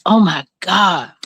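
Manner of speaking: normal talking voice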